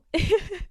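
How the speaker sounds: a quick, high burst of laughter. Real, not acted. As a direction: laugh at your own teasing. Laughs at own tease.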